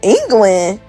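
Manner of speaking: shocked disbelief. Real, not acted. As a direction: blurt it out in loud disbelief. Reacting to surprise England result.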